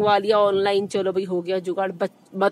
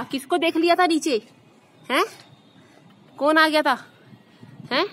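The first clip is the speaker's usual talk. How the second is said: higher, sing-song cooing at the pet; 6 semitones higher than usual. Real, not acted. Mother asks pet Kittu what happened, higher cooing baby talk.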